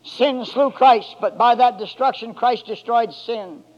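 ordinary talk, nothing marked

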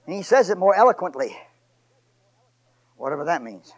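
ordinary talk

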